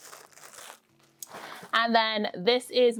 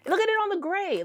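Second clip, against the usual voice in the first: excited; 9 semitones higher than usual. Real, not acted. Gushing over yarn on gray.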